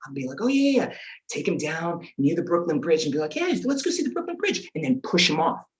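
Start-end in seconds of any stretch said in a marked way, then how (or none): 0.4-0.9 high-pitched innocent agreement; put-on sweet compliance; feigned eagerness. 3.3-4.7 very high singsong suggestion; squeaky, falsely casual invitation; fake innocence. Luring uncle with false innocence.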